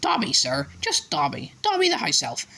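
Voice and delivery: high, proud elf voice; house elf voice. A narrator's reading. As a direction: voce acuta da elfo. Elf introducing himself.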